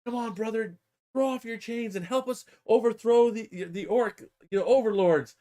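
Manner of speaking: high-pitched pretend rabble-rousing; mock rallying yell. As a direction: urge a comrade to revolt. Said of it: "They're yelling at him".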